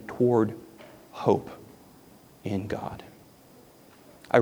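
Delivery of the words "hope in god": hushed whisper; whispered hope in God. Real, not acted. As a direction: drop to a breathy hush. Sermon climax.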